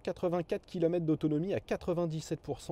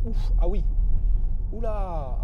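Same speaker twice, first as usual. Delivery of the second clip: surprised outburst; 5 semitones higher, 11 dB louder, slower than usual. Real, not acted. Sudden hard acceleration on test.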